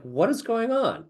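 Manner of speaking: baffled. Retold or imitated. Confused by the British voice again.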